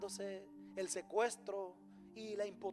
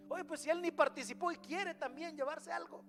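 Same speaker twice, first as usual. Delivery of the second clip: higher-pitched mock grumble; acted resentful complaint; 6 semitones higher than usual. Objecting to sharing with a non-fighter, mock indignation. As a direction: protest as if someone wants an unfair share.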